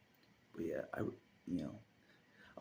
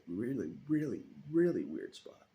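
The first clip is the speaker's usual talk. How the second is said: each really climbing higher and louder; stacking really, louder each time; stress on really; 8 semitones higher, 8 dB louder than usual. Real, not acted. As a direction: hammer every really up to a peak. Emphatic about weird spot.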